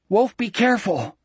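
narrator's restrained shout; shouted warning. A narrator's reading. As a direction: call out a warning with the pitch jumping up. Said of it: "he shouted".

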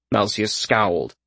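plain reading voice

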